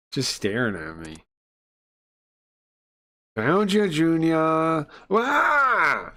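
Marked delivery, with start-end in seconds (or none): 3.4-4.9 put-on creepy child voice. 5.1-6.1 high loud frightened yelp; startled scream. Real, not acted. Jump scare.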